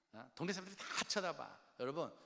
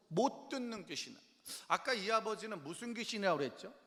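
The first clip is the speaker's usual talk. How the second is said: ordinary talk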